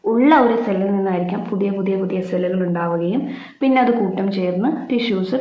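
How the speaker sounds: ordinary delivery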